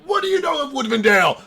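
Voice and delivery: high, loud, fast demand; forceful outburst; shaking interrogation. Acted. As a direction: yell it while shaking someone. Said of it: "fucking shaking him and being like".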